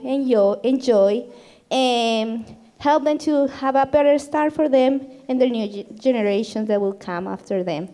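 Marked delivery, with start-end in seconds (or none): none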